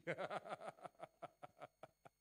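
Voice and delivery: a real amused laugh at his own joke. Real, not acted. Pastor laughing at his own pronoun joke, real laugh. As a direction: let a genuine chuckle roll out.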